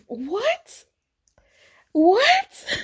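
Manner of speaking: very high, rising disbelief; high-pitched, utterly baffled; incredulous. Real, not acted. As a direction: squeak it out as a stunned question. Disbelief at the comparison she heard.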